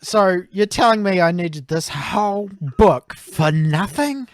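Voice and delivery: high, indignant disbelief; mock outrage. Co-host told the hero dies, mock outraged. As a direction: protest it with playful incredulity.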